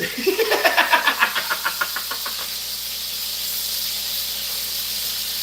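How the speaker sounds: long wheezing high laugh; breathless laugh. Real, not acted. Laughing off the rival streamer's jabs, long and wheezy. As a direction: laugh it out without voice.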